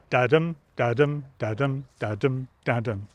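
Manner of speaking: "Da-dum, da-dum" beat, strong on each dum; da-dum rhythm. Shown as a demonstration. Scanning a sonnet line for listeners, chanted da-dums. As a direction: chant the five iambs evenly. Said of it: "five iambs in that line".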